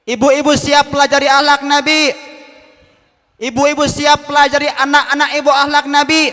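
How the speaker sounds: rally the women to answer; rousing call-out. Real, not acted. Preacher rallies the mothers in the hall, loud call. Calling out to congregation.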